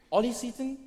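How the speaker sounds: posh English accent on "Ollie Seaton", rising high like a question; practising a posh English name, unsure. Shown as a demonstration. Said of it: "I practiced my English accent".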